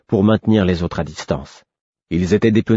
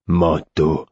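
lower, hollow Manteau from a second voice; low hollow echo; 4 semitones lower than usual. Acted. The male attacker echoing her claim to the coat, low and hollow. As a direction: growl the word back like a rival.

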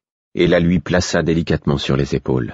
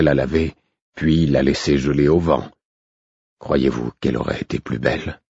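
lower, rough and hard, defending himself; 3 semitones lower than usual. A narrator's reading. Stable master answers Patience about his wound, rough and hard. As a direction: push it out rough and hard, weary and bitter. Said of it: "il avait la voix rauque et dure".

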